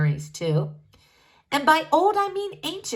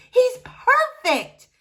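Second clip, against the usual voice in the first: thrilled cry; 5 dB louder, much slower than usual. Acted. Spuds thrilled at finding leader.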